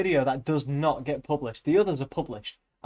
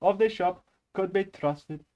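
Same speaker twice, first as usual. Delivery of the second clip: put-on slow, higher Indian-accented reading; measured Indian accent; 5 semitones higher than usual. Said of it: "once had an Indian teacher".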